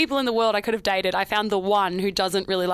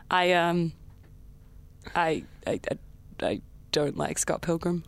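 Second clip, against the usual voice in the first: nervous confession; 6 semitones lower, 6 dB quieter than usual. Pretend.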